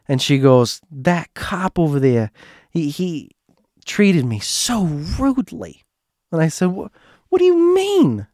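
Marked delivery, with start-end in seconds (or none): 0.9-5.8 higher, slow, aggrieved put-on voice; Joanne. Joanne complains about the cop, affronted. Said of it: "this is my impression of her". 6.7-8.3 very high, squeaky incredulity; retold bafflement. Narrator questions Joanne's complaint, incredulous.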